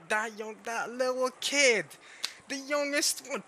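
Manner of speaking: high, drawn-out belittling voice; mock-incredulous parent. Parent talking about the little kid, high mocking voice. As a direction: stretch it out high in mock disbelief.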